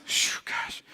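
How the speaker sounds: soft breath of acted dismay; dismayed whisper. Husband hearing how little money is left, dismayed whisper. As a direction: whisper it under a heavy exhale. Husband reacting to low savings.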